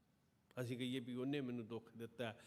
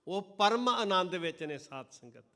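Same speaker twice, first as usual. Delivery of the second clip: loud and higher, preaching at full force; impassioned loud sermon; fervent preaching; 6 semitones higher, 10 dB louder than usual. Acted. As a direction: thunder it at the congregation. Martyrdom passage, full force.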